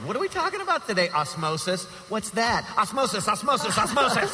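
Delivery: mock clueless teacher, high and fast; bad teacher. Friend mocks him as a teacher repeating osmosis. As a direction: parody a teacher who just repeats the word. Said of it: "you would be the worst teacher in the world".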